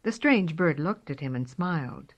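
usual speaking voice

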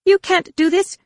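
very high, loud objection; heated protest. A narrator's reading. Darek objecting to Luke's order, heated protest. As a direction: burst out in alarmed protest. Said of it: "Darek protested heatedly".

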